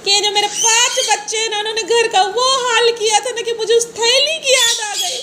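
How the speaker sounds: high-pitched, hammed-up crying; mock sobbing. Mother fakes tears telling daughter her sad story, mock sobbing. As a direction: wail it tearfully, laying it on thick. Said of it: "मैं फूट फूट के रोने लगी".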